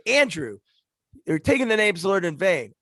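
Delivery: a hint of a prim scolding wife; lightly voiced mom reproaching her husband. Mom scolding dad, retold.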